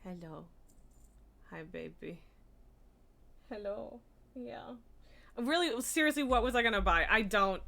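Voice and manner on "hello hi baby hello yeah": very soft cooing. Real, not acted. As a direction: whisper sweetly to a pet. Cooing quietly at cat.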